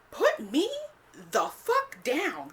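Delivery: high, drawn-out indignant outburst; indignant protest. Retold or imitated. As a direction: stretch out each word, high and loud, in retold outrage. Acted protest at being picked up.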